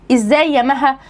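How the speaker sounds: ordinary talk, nothing marked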